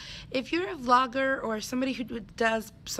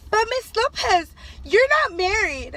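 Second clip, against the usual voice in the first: put-on much higher, loud kid voice; blunt little student; 11 semitones higher, 8 dB louder than usual. Teacher imitating a first grader innocently pointing out she is unmarried, high and loud. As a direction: blurt it with childish innocence. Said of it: "And he goes".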